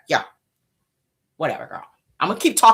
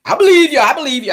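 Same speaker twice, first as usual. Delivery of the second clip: hasty conceding; 8 semitones higher, 5 dB louder than usual. Acted. Hastily conceding to crier.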